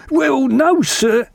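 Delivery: ship's steward. A narrator's reading. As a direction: play a chatty steward explaining himself, pitched high. Voices the steward.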